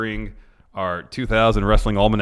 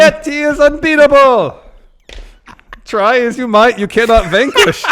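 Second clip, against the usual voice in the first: much higher, loud grand proclamation; old-timey announcer; 11 dB louder than usual. Pretend. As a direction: boom it like an old showman. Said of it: "old-timey voice".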